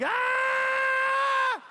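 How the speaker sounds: long high-pitched yelled "Ah"; scream. Retold or imitated. Dad on a big wave, eyes closed, screaming a high yell. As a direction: let out a wild scream up in your head voice. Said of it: "and I scream".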